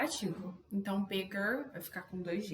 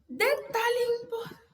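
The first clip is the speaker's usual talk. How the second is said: high, drawn-out words overtaken by a real sneeze coming; about to sneeze; 12 semitones higher, 4 dB louder, slower than usual. Real, not acted. Teacher breaks off mid-lesson as a sneeze comes, about to sneeze. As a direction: start a word and lose it to a rising sneeze. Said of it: "ui, vou espirrar".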